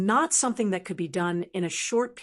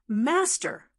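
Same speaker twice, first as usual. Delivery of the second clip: a mild eager call, a touch raised; excited; 4 semitones higher than usual. A narrator's reading. Old God greeting his master, mildly excited. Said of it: "Old God said excitedly".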